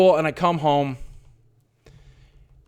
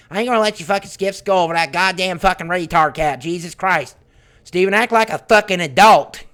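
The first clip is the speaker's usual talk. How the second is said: scolding mother; 4 semitones higher, 4 dB louder than usual. Retold or imitated. Mother scolding son over cat.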